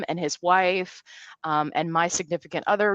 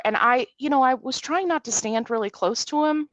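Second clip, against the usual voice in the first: ordinary talk, nothing marked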